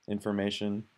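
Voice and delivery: normal talking voice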